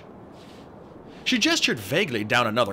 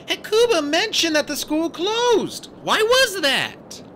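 put-on polite girl; 5 dB louder, slower than usual. A narrator's reading. Annabeth asks politely.

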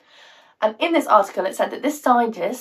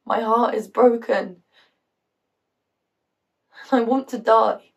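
heartbroken. Acted. Acts heartbroken mother confessing despair.

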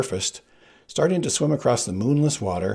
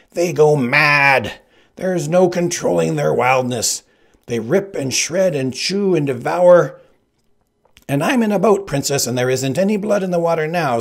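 higher, louder threat; menacing Sicilian; 7 semitones higher, 7 dB louder than usual. Acted. Sicilian threatening Buttercup from the boat, loud.

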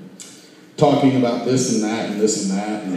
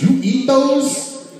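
much higher, slow disbelieving question; shocked kid; 13 semitones higher, much slower than usual. Retold or imitated. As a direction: gasp it out like a horrified child. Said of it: "And I said".

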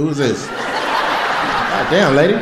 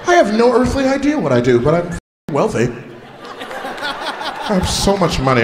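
higher, clueless rich guy voice, slowing down; 6 semitones higher than usual. Retold or imitated. Comic mocking the audience member as a clueless rich guy. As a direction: play the oblivious wealthy man laughing it off.

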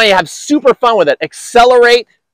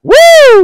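excited whoop; 7 dB louder than usual. Real, not acted. Coach cheers great shot.

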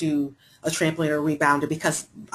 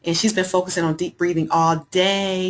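normal talking voice

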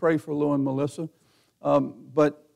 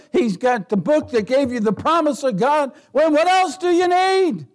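loud, higher preaching shout; exhorting shout; 8 semitones higher, 4 dB louder than usual. Acted. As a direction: shout the good news at them. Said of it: "Christians sit there like".

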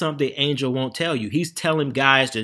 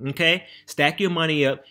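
ordinary talk, nothing marked

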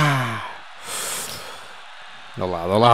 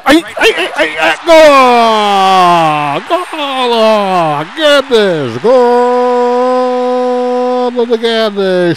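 very high, loud goal cry with laughter; goal shout; 13 semitones higher, 8 dB louder, much slower than usual. Real, not acted. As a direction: scream the goal. Goal call. (grito de golo)

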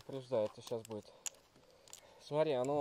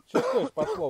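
surprised; 5 dB louder than usual. Real, not acted. Feels the heat coming.

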